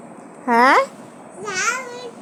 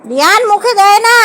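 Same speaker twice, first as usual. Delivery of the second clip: firm warning; 4 semitones higher, 5 dB louder than usual. Real, not acted. Firmer repeat, calling child's name. (গলা চড়িয়ে বারণ)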